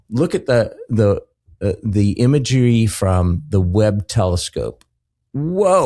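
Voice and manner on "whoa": high, drawn-out whoa; awed exclamation. Acted. Marveling at the Webb telescope imagery, awed. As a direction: let amazement swoop up and down.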